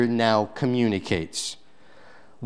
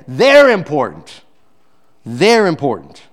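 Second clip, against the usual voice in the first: forceful, much louder declaration; loud insistence; 3 semitones higher, 8 dB louder than usual. Real, not acted. Preacher insisting to the church that kids matter, loudly. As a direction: drive it home with full voice.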